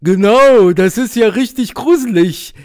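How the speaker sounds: loud braying donkey. Acted. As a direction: bray it out. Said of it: "ergänzt Esel Fred".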